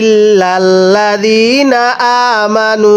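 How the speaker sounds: slow melodic tilawat, drawn-out vowels; slow Quran recitation. Shown as a demonstration. Teacher recites the verse for students, slow melodic chant. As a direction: chant the verse slowly and evenly. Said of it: "এই আয়াতের শুরু থেকে আমার সাথে পড়বেন".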